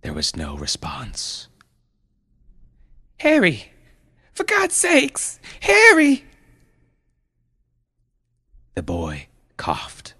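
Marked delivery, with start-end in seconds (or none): 3.2-6.2 very high panicked cry, building louder; frantic calling. Acted. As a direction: shriek for your child to answer. Mother calls unresponsive son.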